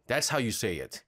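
usual speaking voice

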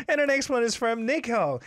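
usual speaking voice

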